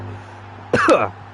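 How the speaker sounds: harsh coughing fit; choking cough. Real, not acted. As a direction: cough hard, still choking. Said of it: "choking there".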